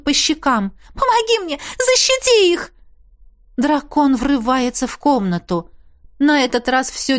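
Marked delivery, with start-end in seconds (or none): 0.9-2.7 put-on girl in tears screaming for help. A narrator's reading. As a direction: cry out with all your strength. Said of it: "кричу изо всех сил, слёзы текут".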